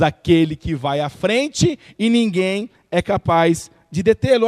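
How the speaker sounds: loud fervent preaching, "convosco" drawn out very slowly; full-force preaching. Acted. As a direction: proclaim it to the whole people.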